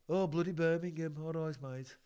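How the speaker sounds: mock Brummie accent; Birmingham accent. Host greeting Birmingham, in a mock Brummie accent. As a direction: put on broad Brummie vowels.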